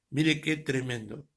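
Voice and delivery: normal talking voice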